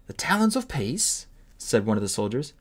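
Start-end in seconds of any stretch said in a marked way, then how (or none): 0.1-1.3 high startled question; surprised. Acted. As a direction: blurt it out amazed. Soldier surprised.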